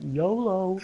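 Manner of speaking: high, loud drawn-out dwarf cry; mock battle cry. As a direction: call it out like a reckless dwarf.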